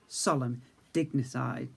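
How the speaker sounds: usual speaking voice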